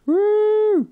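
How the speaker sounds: high whooping cheer. Real, not acted.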